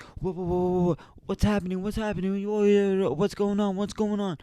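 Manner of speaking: high falsetto mock panic; freaking-out parents. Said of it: "My mom and dad come freaking out".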